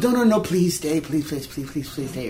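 soft, rapid-fire begging; lightly imitated plea; hurried pleading. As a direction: run the pleases together, tailing off quietly. Acting out her pleading.

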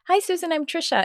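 high-pitched polite introduction; acted reply. Introducing herself back to an acquaintance, high retold greeting. Acts out her own reply.

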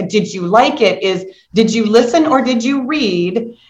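ordinary talk

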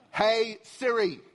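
very slow, deliberate phone command; drawn-out, exasperated wake-up call; strained patience. Retold or imitated. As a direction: address the phone with laboured care. Yet another weary attempt.

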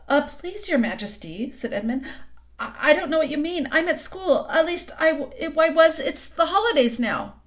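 frightened stammer. A narrator's reading. Edmund stammers to queen.

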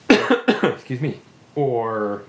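coughing, throat caught; clearing a choked throat; choking. Real, not acted. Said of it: "I'm just choking on the radio".

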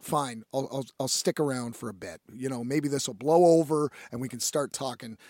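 low, subdued giving in; mild retold resignation; grudging resignation. Retold reluctant agreement to stay.

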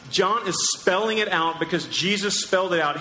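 usual speaking voice

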